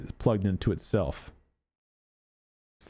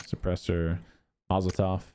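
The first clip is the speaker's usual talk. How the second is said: ordinary delivery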